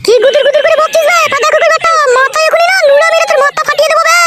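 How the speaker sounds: high-pitched wife's threat; angry threatening. Acted. Wife threatens to crack husband's head, high angry rant. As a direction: rage at him in a high voice.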